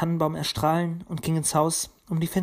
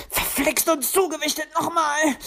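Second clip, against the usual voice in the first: much higher sharp yelp of pain; pained cry; 11 semitones higher, 3 dB louder than usual. A narrator's reading. Luzi cries out after landing on her twisted foot. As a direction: cry out as you twist your ankle. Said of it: "schrie sie".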